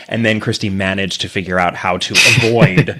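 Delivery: normal talking voice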